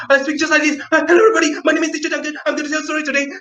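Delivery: rapid, high, rushed chatter; very fast. Shown as a demonstration. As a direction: race through it like you can't stop. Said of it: "if Duncan speaks very fast".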